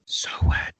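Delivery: creepy whispered aside; playful eerie whisper. Pretend. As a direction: whisper it for comic effect. Creepy wet well-girl joke.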